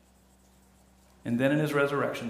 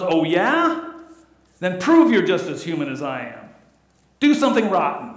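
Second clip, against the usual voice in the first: loud, scoffing retort; Archie Bunker voice; 4 semitones higher, 8 dB louder than usual. Retold or imitated. Archie scoffs at his wife, loud. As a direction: bark back like Archie. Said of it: "And Archie responds".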